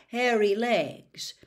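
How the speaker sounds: ordinary delivery, nothing marked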